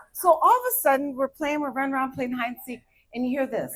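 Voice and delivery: normal talking voice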